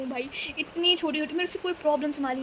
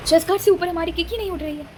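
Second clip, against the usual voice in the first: louder, stress on से in chest guard से ऊपर; exasperated louder retelling; louder emphatic repeat; 3 semitones higher, 7 dB louder than usual. Real, not acted. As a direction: push it out in exasperation. Repeating the complaint with more force.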